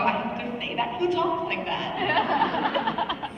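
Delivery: laughing disbelief. Acted. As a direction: laugh at the line as you read it. Mock reading her script.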